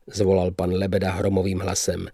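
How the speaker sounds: plain reading voice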